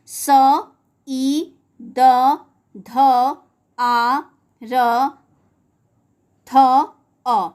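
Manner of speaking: each sound of Siddharth said apart with pauses; slow spelling out. Shown as a demonstration. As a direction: split Siddharth into sounds, one at a time. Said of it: "उसका वर्ण विच्छेद होगा". (सिद्धार्थ के वर्ण रुक-रुक कर बोलें)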